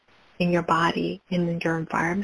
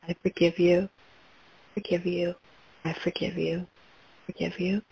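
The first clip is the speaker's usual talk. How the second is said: quiet, murmured forgiveness; soft forgiveness; 6 dB quieter than usual. Acted. Guide voices forgiving others for listeners, softly murmured. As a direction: let it fall to a hush.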